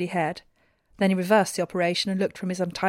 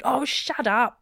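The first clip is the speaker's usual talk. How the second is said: higher, drawn-out exasperated snap; angry; 4 semitones higher, much slower than usual. A narrator's reading. Man silences the squabbling bears, angry and drawn out. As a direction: cut the squabble off with a slow, cross put-down. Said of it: "in an angry voice".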